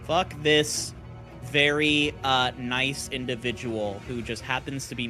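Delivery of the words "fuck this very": ordinary talk, nothing marked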